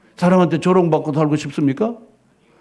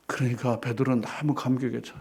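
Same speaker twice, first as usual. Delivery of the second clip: ordinary talk, nothing marked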